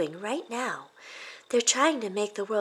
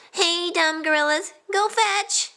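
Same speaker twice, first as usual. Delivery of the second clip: mocking yell; 12 semitones higher, slower than usual. Todd taunting the kids while throwing cans. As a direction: jeer at the kids like a bully. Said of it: "he yelled".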